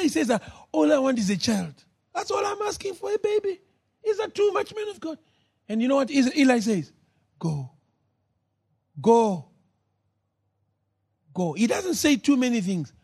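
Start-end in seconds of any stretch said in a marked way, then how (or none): 0.7-5.2 high pleading woman. Acted. Hannah pleads with the priest. 7.4-11.5 lower, a curt low dismissal; curt priest. Acted. Eli curtly dismisses Hannah.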